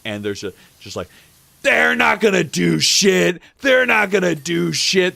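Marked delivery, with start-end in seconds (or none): 1.6-5.2 imitated angry shouting in a parking lot; high, loud mimicked yell. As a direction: holler it like someone mid-argument. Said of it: "they're just yelling at each other".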